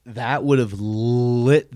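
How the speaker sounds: loud, high hooting hype; excited whooping. Acted. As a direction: whoop it up, stretching the big words. Whooping over imagined crowd reaction.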